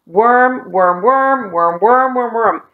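"worm" repeated in a rolling singsong; "worm" looping like a stuck thought; obsessive repetition. Acted. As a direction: chant "worm" over and over, pitch swinging.

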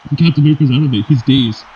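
ordinary talk

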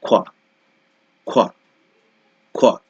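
খোয়া as a short kwa. Shown as a demonstration. Teacher modelling the qu sound for students. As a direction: clip খোয়া quick and rounded. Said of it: "এটাকে উচ্চারণ করতে হবে".

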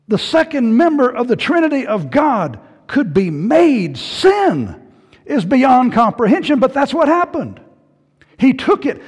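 loud, high-pitched sermon delivery; impassioned preaching. Real, not acted. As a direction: drive it home to the congregation. Preaches at full force.

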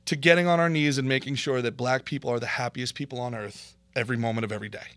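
ordinary talk

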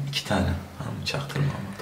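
hushed whisper. Real, not acted. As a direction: whisper it so he won't hear. Secret instruction off camera.

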